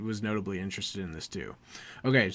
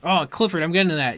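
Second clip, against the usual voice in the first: loud, higher-pitched interrupted protest; exasperated; 5 semitones higher, 7 dB louder, faster than usual. Real, not acted. Host chiding co-host for getting ahead, exasperated. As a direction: cut in, exasperated at being jumped ahead.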